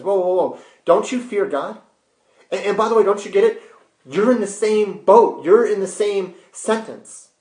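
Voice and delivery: high-pitched, emphatic reproof; urgent rebuke. Acted. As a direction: cut in and set the mocker straight. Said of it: "the other criminal rebuked him".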